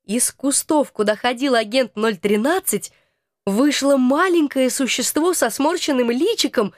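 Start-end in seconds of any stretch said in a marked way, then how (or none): none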